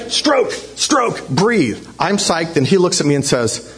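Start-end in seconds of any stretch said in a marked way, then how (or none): 0.1-1.8 higher-pitched cues, easing down on the last; mock coaching yell. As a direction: call out the rhythm like a swim coach. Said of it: "I'm gonna yell".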